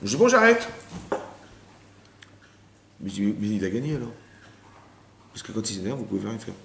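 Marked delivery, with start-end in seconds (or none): 0.2-0.7 high mock-resigned "bon, j'arrête"; mock resignation, higher; resigned wife. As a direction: play the wife caving in, pitched up. Wife giving up, imitated.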